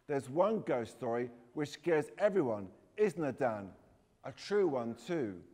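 restrained shout across the room. A narrator's reading. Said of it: "Harry shouted across at me".

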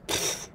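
dismissive snort; a short scornful pfft. Acted. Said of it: "Honors himself snorted".

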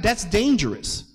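ordinary talk

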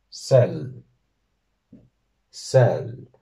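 "Sel" with a held, long L at the end; teacher modelling the Sindarin for daughter. Shown as a demonstration. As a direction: draw out the final L of "Sel". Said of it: "dos L's al final".

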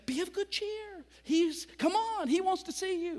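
high, sugary coaxing call; overly sweet come-along; fake-friendly crowd. Crowd suddenly coaxes the blind man to come, sugary call. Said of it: "they said to him".

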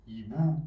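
"ایبو" as ee-boo, with a clear oo ending; correct pronunciation. Shown as a demonstration. Teacher demonstrating how to pronounce this part, oo ending. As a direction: end "ایبو" on a rounded oo.

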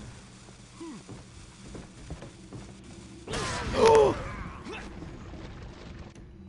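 sharp high shocked gasp; loud gasp. Real, not acted. Sudden scare in game.

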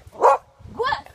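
loud, high sharp command; firm dog commands. Real, not acted. Repeated speak command, louder.

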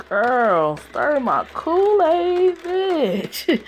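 high, loud, drawn-out whoop; hyped-up reaction. Real, not acted. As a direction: stretch each word out high in excitement. Hyped reaction to emotional confession.

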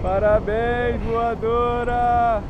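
slow, stretched congratulations; drawn out. Real, not acted. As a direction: stretch every syllable out.

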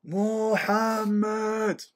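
long drawn-out call up to the flats; high, slow calling-out; shouting up a name. Retold or imitated. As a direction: call up to the top floor, stretching the name. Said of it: "he starts shouting up".

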